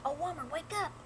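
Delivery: high frightened plea; urgent and afraid, half whispered; scared whisper. Acted. Man calls wife in fear.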